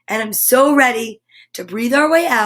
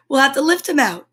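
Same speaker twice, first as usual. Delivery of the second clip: ordinary delivery, nothing marked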